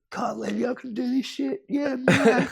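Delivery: high, squeaky put-on voice of disbelief; mock giddy excitement. Surfer celebrating his own success, high giddy mock voice. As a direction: squeal the win like a kid. Retold celebration after winning.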